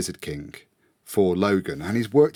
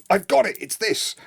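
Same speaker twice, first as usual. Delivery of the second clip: excited eureka; 11 semitones higher than usual. Retold or imitated. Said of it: "Andy goes".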